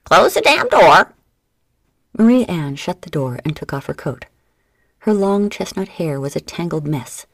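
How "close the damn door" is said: high, loud, slow parrot squawk; shrill-free bird cry, much higher and louder; parrot screech. A narrator's reading. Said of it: "Checkmate screeched".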